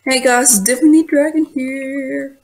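put-on vlogger greeting; video intro. Vlogger opening a video for viewers, video intro.

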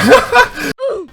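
high-pitched burst of laughing; gleeful high cackle; laughter. Real, not acted. Laughs after joking about prize.

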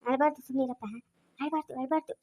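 low, soft shushing; gently quieten a crying child; soft hushing. Acted. Grown-up telling the child not to cry, hushed.

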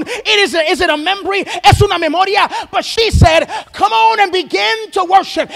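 preacher pressing the congregation to worship; challenging preaching. Acted. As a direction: press the listeners to open up.

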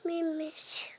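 softly whispered plea. Real, not acted. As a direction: whisper it under the breath. Child pleading with mom.

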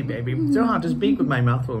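ordinary delivery, nothing marked

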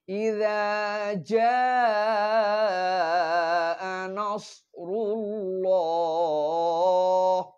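slow melodic recitation; tajweed recitation. Shown as a demonstration. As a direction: draw out ইযা জাআ নাসরুল্লাহ like Quran recitation. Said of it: "একটু খেয়াল করতে হবে পড়ার সময়".

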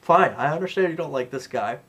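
ordinary talk, nothing marked

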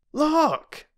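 high, sharp exclamation; sudden cry. A narrator's reading. Boy suddenly alerting the girls, high cry. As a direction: call out, startled. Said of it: "cried Peter suddenly".

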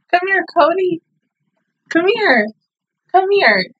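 high-pitched coaxing call; calling Cody over. Real, not acted. Viewer calls Cody over after sad ending, high coaxing voice.

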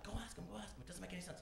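hushed coworker prodding; mock hushed egging. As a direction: egg him on under your breath. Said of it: "he's just like".

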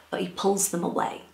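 plain reading voice

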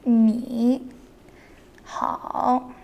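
"Ni" and "hao" drawn out one by one; tones shown separately. Shown as a demonstration. Teacher breaking down a greeting, "Ni hao" in separate toned syllables. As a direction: say "Ni", pause, then "hao", stretching each tone. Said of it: "Notice the tones".

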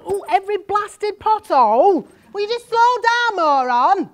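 high, loud outburst at the driver; angry shout. Acted. Passenger on a bumpy bus yells at the driver, angry shout. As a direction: yell it in exasperation at a careless driver.

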